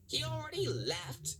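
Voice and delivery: high, surprised cry; shocked he already left; cried out. A narrator's reading. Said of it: "cried Mister Power".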